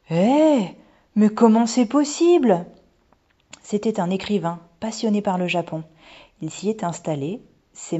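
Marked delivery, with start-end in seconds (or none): 0.1-2.6 put-on high, loud little-girl amazement; astonished. A narrator's reading. Daughter learns of Irish ancestor, astonished. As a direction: cry out in disbelief.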